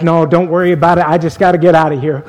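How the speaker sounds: fast, hurried reply; brushing it off. Retold or imitated. Man hurrying past the curious clerk, fast dismissive words. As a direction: wave it off quickly. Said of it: "my friend was like".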